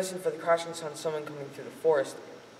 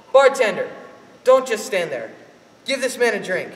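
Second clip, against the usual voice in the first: loud order; 4 semitones higher, 7 dB louder than usual. Acted. Pa calling bartender.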